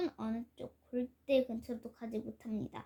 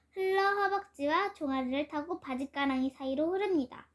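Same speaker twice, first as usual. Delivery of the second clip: ordinary delivery, nothing marked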